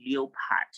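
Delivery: leopard as LEE-oh-pad; three syllables in leopard, LEE-oh-pad; wrong spelling pronunciation. Shown as a demonstration. Teacher telling students to stop, leopard as LEE-oh-pad. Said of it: "Stop saying leopard".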